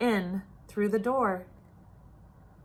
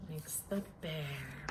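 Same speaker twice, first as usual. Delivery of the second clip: much softer, breath held; much softer repeat, held in suspense; hushed build-up; 8 semitones lower, 16 dB quieter than usual. A narrator's reading. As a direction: drop to a near-hush before the sneeze.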